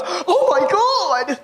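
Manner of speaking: shocked gasp. Retold or imitated. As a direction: stretch it out in horror. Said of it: "She looks at me and goes".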